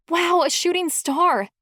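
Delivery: high, thrilled wonder; bright burst of awe; excited gasp. Acted. Sees a shooting star.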